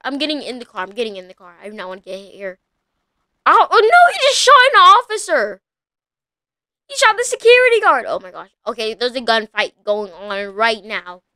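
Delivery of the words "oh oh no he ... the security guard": high, loud horrified outburst; shocked cry. Real, not acted. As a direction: gasp it out in shock. Officer shot in gunfight.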